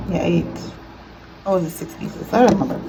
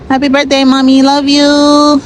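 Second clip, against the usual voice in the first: higher and louder birthday call; loud birthday wish; 8 semitones higher, 5 dB louder than usual. Real, not acted.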